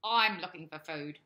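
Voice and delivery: loud croaky frog voice. Acted. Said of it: "croaked the frog".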